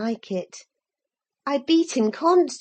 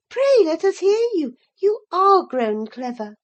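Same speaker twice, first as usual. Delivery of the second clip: teasing encouragement; 5 semitones higher, 4 dB louder than usual. Acted. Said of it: "I exclaimed".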